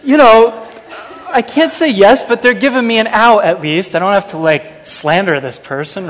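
high, loud, agitated dithering; preacher reenacts fretting over the Uber survey, hammed up and loud; fretful inner monologue. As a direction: act out the anxious back and forth.

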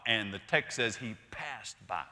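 ordinary talk

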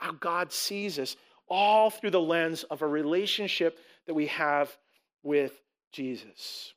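ordinary talk, nothing marked

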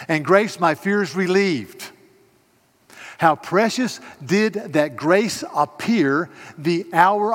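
loud, high, impassioned preaching voice; full-force preaching. Acted. Pastor preaching the hymn's meaning to the congregation, loud and fervent.

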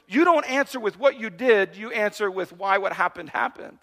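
ordinary delivery

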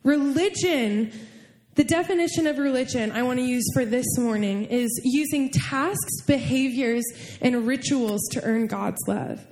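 normal talking voice

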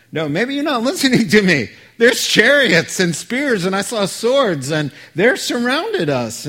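high, loud, fast panicked pleading; frantic servant. Acted. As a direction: blurt it out in rising panic.

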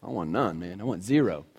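what most of us would say about impurity; firm refusal. Retold or imitated. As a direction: state it with flat conviction. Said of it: "we'd say".

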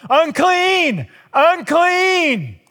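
high, loud warning cry, held long; yelling. Retold or imitated. Woman warning crowds she is unclean, loud high yell. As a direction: call out to warn the whole crowd away. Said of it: "she would have to yell".

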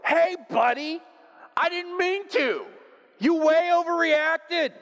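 high, loud, drawn-out yell out the window; mock yell. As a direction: shout it back at the cyclist, slowly. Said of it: "yell at him and go".

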